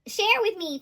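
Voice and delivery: mock demanding lover. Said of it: "energy of, like".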